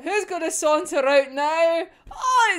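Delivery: ordinary delivery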